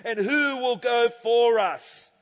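building divine summons from the pulpit; voice of the Lord. Acted. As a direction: call it out grandly, stretching each word. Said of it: "the voice of the Lord saying".

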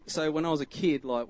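usual speaking voice

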